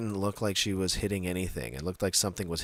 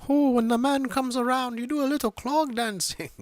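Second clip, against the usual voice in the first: put-on much higher, hammy Irish leprechaun accent; 3 dB louder than usual. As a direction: squeak it like a cartoon Irish leprechaun. Mocking Irish leprechaun stereotype.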